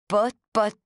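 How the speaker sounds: mocking mimicry. Rosaleen mocking the narrator's stammer, a light sneering echo. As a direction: throw her stammer back at her, lightly mocking. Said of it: "Rosaleen mimicked me".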